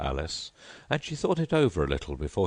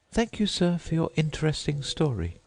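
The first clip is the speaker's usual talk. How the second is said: put-on much higher polite girl voice; Alice's voice; 11 semitones higher than usual. A narrator's reading. Alice thanking the Mock Turtle, high and polite. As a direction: pitch up into a polite young girl.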